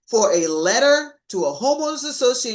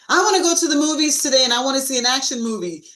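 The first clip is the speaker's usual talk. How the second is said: eager demand; 6 semitones higher, 5 dB louder, faster than usual. Retold or imitated. Voicing the other person's demand.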